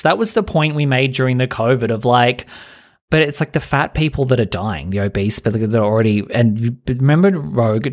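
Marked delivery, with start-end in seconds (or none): none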